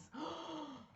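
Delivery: sudden breathless gasp; a real startled intake of breath; sharp gasp. Real, not acted. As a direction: gasp of shock. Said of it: "I just got winded".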